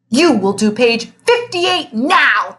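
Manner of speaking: slow, loud, booming command; thundering. A narrator's reading. The strict teacher thundering an order at his old student, slow and loud. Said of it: "thundered Mister Kinderschmacher".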